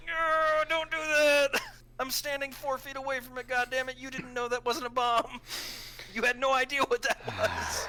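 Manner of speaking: high, loud, half-laughing protest; exasperated yell. Retold or imitated. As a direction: scold him in disbelief, laughing. Yelling at cop who kicked bomb.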